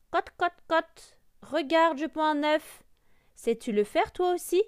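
high, loud hen voice; clucking hen. A narrator's reading. Hen shows off egg-laying to the duckling, clucking. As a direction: cluck proudly, pitched right up. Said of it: "demanda la poule".

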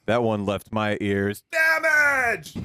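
slow line ending in a shout. Acted. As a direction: erupt into a drawn-out shout on the last word.

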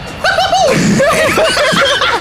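high hysterical laugh-scream; laugh-scream from real fear; shrieking panicked laugh. Real, not acted. Plane dropping, panic.

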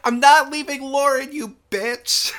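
high, loud snapping yell; yelling back. Retold or imitated. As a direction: fire it back in a rage. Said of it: "Mel yells back".

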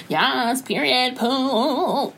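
high, drawn-out mock sassy squeal; stretched, high, showy slang impression; Bretman Rock. Mimicking Bretman's put-on slang.

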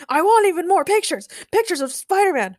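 mock the boss's demands in a high, punchy voice; high-pitched mock yell. A narrator's reading. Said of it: "mocked Daniel".

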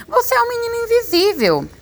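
very high, agitated exclamation; agitado. A narrator's reading. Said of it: "todo agitado e diz". (exclamação agitada, bem aguda)

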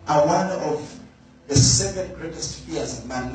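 ordinary delivery

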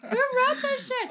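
slow, very high, loud mock outrage; outraged outburst. Fan railing at the writers, outraged outburst. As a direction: shriek it, stretching the words in comic disbelief. Outburst over the twisted story.

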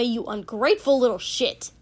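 loud, furious outburst; exploding in anger. A narrator's reading. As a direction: spit it out in rage. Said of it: "Kotsky exploded".

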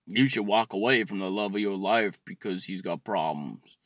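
low-pitched mock counselor voice; mock TV advice. Mimics Dr. Phil giving advice.

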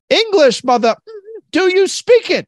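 shouted high and loud, Pulp Fiction style; Samuel L. Jackson impression. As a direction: bark the line like an angry gangster. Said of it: "Samuel L. Jackson?".

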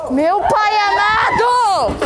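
high, drawn-out shout of disbelief; exasperated shout. Real, not acted. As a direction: cry it out, fed up. Exasperated at classmate's refusal.

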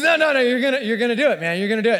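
pushy urging. Retold or imitated. Friend urging him onto rope.

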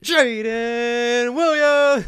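very high, very slow, loud drawn-out name call; UFC announcer. Retold or imitated. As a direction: stretch the name like announcing a fighter. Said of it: "You didn't have to keep on going".

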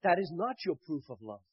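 fervent, loud sermon delivery; preaching. Acted. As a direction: preach it out with full force. Preaching to the audience.

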